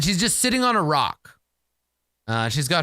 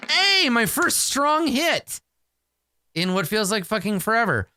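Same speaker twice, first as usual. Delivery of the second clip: relieved cheer; 6 semitones higher than usual. Real, not acted. Player cheers a strong hit.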